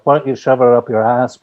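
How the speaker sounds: a lightly voiced Scouse retort; mild Liverpool put-down, half-hearted; Liverpool accent. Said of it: "and he says".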